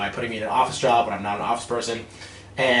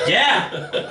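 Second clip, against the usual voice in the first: high, triumphant yeah with a laugh; super excited; 10 semitones higher, 4 dB louder than usual. Retold or imitated. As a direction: crow it out excitedly. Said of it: "I was just excited".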